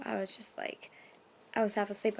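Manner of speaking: ordinary delivery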